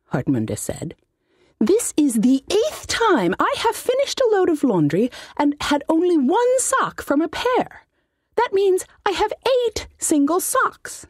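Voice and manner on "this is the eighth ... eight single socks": high, put-on voice of a fussy old halfling mage; high-voiced wizard. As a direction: whine it like a pompous little wizard. Wizard complains about socks.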